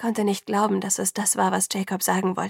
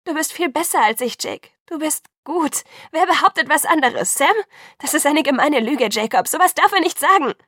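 high, loud, desperate outburst; rising furious shout; 7 semitones higher, 7 dB louder than usual. A narrator's reading. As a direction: yell it in his defence. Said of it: "Jetzt schrie ich wieder".

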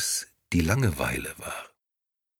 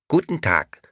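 lightly voiced doll, a bit higher; sweet, empty doll voice; talking doll; 7 semitones higher, 4 dB louder than usual. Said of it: "sagte die Puppe".

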